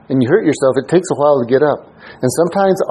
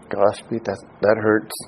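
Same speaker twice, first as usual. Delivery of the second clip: mock wounded aside; 7 semitones lower, 8 dB quieter than usual. Mock self-rebuke aside.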